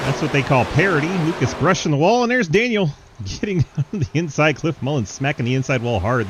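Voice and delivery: loud and high, rising excitement; excited race call. Real, not acted. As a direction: call it like a thrilling moment.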